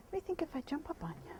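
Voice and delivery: quick hushed whisper; whisper to horse. Real, not acted. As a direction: ask the mare softly, almost breathless. Whispering to horse before mounting.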